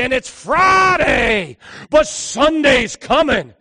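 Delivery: high, loud, rhythmic sermon cries; full-force preaching. Acted. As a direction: build the crowd to a peak.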